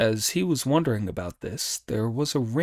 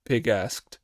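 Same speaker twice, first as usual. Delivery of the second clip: ordinary delivery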